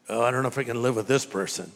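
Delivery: low, hurried mock grumble; lightly acted doubt, dropped in pitch and rattled off; mock reluctance. Someone fed up with a bad roommate, mock grumbling.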